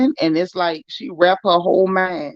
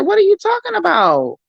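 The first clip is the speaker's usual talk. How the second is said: high, disbelieving squeal of a question; incredulous retort; 12 semitones higher than usual. Retold or imitated. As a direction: act out your shock at her nonsense. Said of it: "I'm like".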